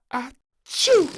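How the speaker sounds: big explosive high achoo; huge sneeze. A narrator's reading. Little princess sneezes blowing up her ring, explosive achoo. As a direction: sneeze hard enough to send the ring flying. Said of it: "sneezed so hard".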